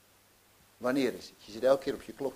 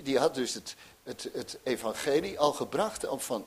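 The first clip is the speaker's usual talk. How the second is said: usual speaking voice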